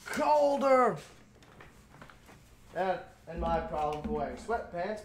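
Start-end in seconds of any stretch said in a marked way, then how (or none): 0.0-1.0 high, loud shouted demand; shouting. Real, not acted. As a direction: yell the word out. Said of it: "This room needs to get colder".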